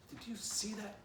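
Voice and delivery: high excited cry; screamed. A narrator's reading. Poo Poo to Stick Dog, high and excited. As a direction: blurt it out high, eyes on the tree. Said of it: "Poo Poo screamed".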